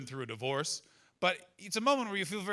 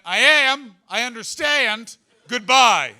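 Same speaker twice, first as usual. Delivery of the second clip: cop's voice; 7 semitones higher, 10 dB louder, much slower than usual. Retold or imitated. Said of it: "he went".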